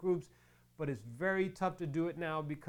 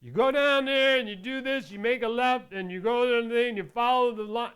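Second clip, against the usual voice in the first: loud, high, barking out directions at a customer; mean DMV clerk; 7 semitones higher, 10 dB louder than usual. Retold or imitated. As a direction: play the grumpy clerk snapping out the way. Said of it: "she looked like she was mean".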